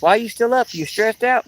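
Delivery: high, mock worried wife; wife's voice. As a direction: pitch it way up like the wife asking. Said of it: "she goes".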